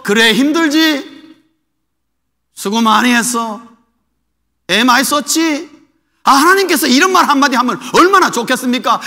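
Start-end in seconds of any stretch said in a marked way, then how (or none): 0.0-5.7 slow, gentle consoling; comforting God. Pretend. Preacher imagining God consoling weary Elijah, slow and gentle. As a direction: soothe a tired servant slowly.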